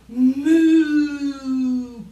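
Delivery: a long, full cartoon moo; cow moo. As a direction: bellow a moo for the kids.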